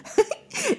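squeaky high giggle. Acted. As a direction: let out a tiny giggle. Pepe laughs.

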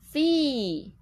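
long drawn-out "fee"; mouth-shape demo. Shown as a demonstration. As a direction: stretch "fee" slowly for a learner. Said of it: "嘴型是这样". (示范口型，把fee拉长慢念)

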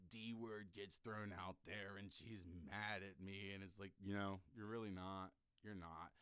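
ordinary talk

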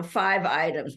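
normal talking voice

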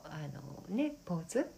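normal talking voice

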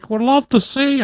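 high put-on child's voice; Fester. Fester luring Emily to the house, unconvincing child impersonation. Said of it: "putting on a child's".

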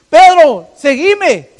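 loud, high call of the Lord; Jesus calling. Retold or imitated. Jesus calls the fisherman, loud high call. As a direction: call Pedro to follow. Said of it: "el Señor le dice".

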